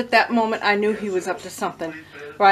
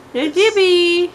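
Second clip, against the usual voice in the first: cooing at cat; 7 semitones higher, 5 dB louder, slower than usual. Real, not acted. Owner cooing at her cat Yippee, high baby talk.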